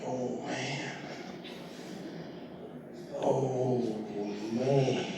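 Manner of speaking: soft dismayed mutter under his breath. Retold or imitated. Kid stuck choosing a plant, muttering soft dismay. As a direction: groan it quietly to yourself. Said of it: "and I'm like".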